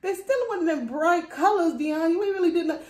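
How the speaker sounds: razz her like an unimpressed husband; teasing husband. Retold or imitated. Husband teasing that her sneakers aren't colorful, high and mocking. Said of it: "He said".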